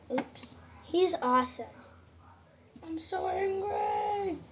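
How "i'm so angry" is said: high, slow, drawn-out mock roar; mock angry. Kid playing his angry toy, high slow shout. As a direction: ham up a cartoon rage, slowly. Kid voicing Terrafin's anger.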